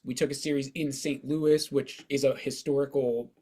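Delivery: ordinary delivery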